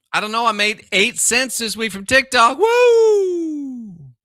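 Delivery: sarcastic victory shout; mock cheer. Real, not acted. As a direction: celebrate eight cents like a jackpot. Mock celebration of tiny earnings.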